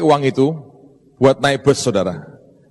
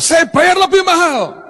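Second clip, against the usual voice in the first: high, haughty offer to pay more; angry rich man. Retold or imitated. Rich man to clerk, offering more money, haughty and high. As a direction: push your money at him, high and indignant. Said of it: "Marah ini orang".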